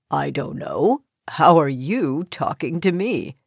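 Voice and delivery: low, soft frog voice; deep-voiced frog with a touch of amusement; amused frog. A narrator's reading. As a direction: toss the question back, deep and amused. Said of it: "the frog asked with a laugh".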